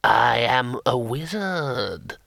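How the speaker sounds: very slow ghostly declaration; voice of the grave. A narrator's reading. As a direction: stretch each word like a dead wizard. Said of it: "in a voice of worms and sepulchres".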